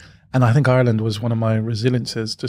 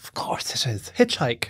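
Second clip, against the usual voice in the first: mum whispering, much softer; mum's whisper; 7 dB quieter than usual. Retold or imitated. Mum whispers her advice to hitchhike. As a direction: hush it like a sly mother. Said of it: "my mum said".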